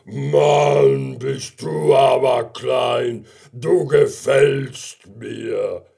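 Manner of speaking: loud, slow, booming bear; big bear voice. Acted. Brown bear sees tiny gummy bear, slow booming delight. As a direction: boom it slowly in delighted wonder.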